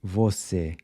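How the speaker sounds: Você ending in a tight, closed E; Você, mouth nearly shut on the E. Shown as a demonstration. Teacher models Portuguese Você with a closed E. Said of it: "closed E".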